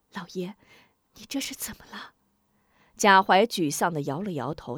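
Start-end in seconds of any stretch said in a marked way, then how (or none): 0.1-2.1 soft, fast whispered question; careful question. A narrator's reading. Wife quietly asks her husband what happened, whispering. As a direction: ask gently so no one else hears. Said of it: "小心问道".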